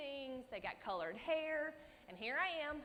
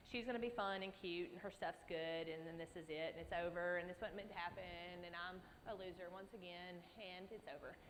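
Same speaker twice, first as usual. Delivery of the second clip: fading gloom; 4 semitones lower, 7 dB quieter than usual. Retold or imitated. Inner despair behind the perky girl.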